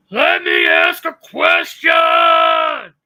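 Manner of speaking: loud, high, very slow mock shout; mocking her voice. Mocking the woman who asked on the panel, hammed-up yell. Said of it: "let me try to do her voice".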